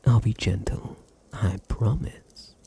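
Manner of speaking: normal talking voice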